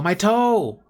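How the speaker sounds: high, loud cry of pain; pained shout. Shown as a demonstration. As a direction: shout it like your toe really hurts. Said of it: "You'd be shouting 'cause your toe hurts".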